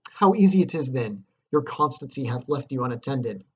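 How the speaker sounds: loud, scornful and brisk; cold Lady Macbeth. Acted. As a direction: chide him coolly while you hurry him off. Dramatic reading of Lady Macbeth.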